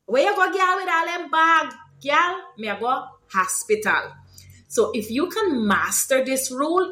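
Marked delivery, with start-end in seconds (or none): 0.1-4.1 raised-pitch Guyanese Creolese street exchange; lively Guyanese Creolese question and answer; Creolese example. Shown as a demonstration.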